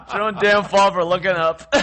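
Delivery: high and loud through laughter; laughing tease. Real, not acted. Teasing a friend for the blooper, laughing loudly. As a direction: tease him while cracking up.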